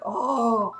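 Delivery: high, drawn-out mock "oh"; imitated shocked group. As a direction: cry out like stunned onlookers. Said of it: "rest of the group just like".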